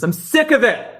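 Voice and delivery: high, exasperated scolding imitation; mock scolding. Jogger fed up with protesters, mock scolding. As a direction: tell off the kids, hammed up. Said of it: "The way you'd scold children".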